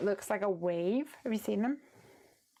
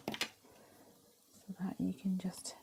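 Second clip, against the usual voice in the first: whispering; 7 dB quieter, much slower than usual. Real, not acted. Craft work aside.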